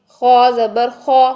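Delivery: खो ज़बर खो said slow and full, the vowel rounded to ओ; slow, heavy खो with a rounded ओ; heavy letter sound. Shown as a demonstration. Teacher showing students the heavy letter sound. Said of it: "मोटे हरूफ की sound क्या होगी".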